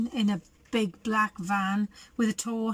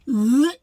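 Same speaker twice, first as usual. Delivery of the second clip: disgusted ugh; 8 dB louder than usual. Acted. Crooks digging through muck.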